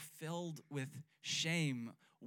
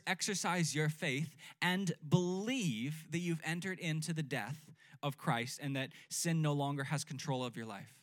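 ordinary talk

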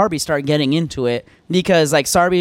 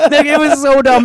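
laughing; 9 semitones higher, 6 dB louder than usual. Real, not acted. Laughing at the memory.

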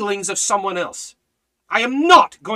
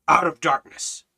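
usual speaking voice

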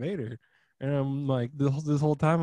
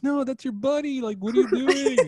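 mock plea at screen; 13 semitones higher, 9 dB louder than usual. Telling a friend how he yelled at Anakin, high and loud. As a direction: yell at the movie like it can hear.

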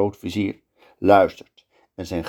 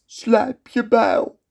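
much higher, very slow cold order; sultan's command; 13 semitones higher, much slower than usual. Acted. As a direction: order the executioner slowly and coldly. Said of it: "roept hij zijn beul".

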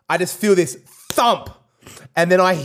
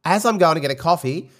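normal talking voice

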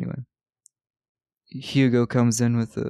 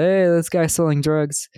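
higher, louder mock call-out; 6 semitones higher, 5 dB louder than usual. Hugo bursting in to save the day, calling out. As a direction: call it out, raised and loud. Acted tip-off in retold scene.

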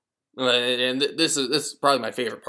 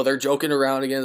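usual speaking voice

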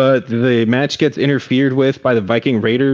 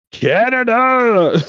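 high, stretched-out yell of the country's name; mock crowd-hype shout; 13 semitones higher, much slower than usual. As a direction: drag it out like rallying a crowd. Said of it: "He might have been like".

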